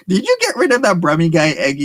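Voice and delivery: playful mock accusation, laughing; laughing tease. Real, not acted. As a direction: rib Eggy while cracking up. Teasing Eggy about her lover.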